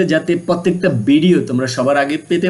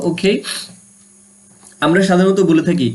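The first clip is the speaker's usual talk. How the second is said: normal talking voice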